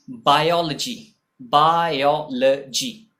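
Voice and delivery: "बायोलॉजी" drawn out slowly, ending in a short luh-jee; बायोलॉजी as baa-yo-luh-jee; careful pronunciation. Shown as a demonstration. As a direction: sound out "बायोलॉजी" syllable by syllable. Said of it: "इसको बोलते हैं".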